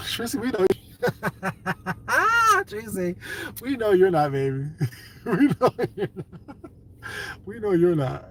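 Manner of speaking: laughing through the words, high and teasing. Real, not acted. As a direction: giggle while teasing Tracy. Laughing at Tracy.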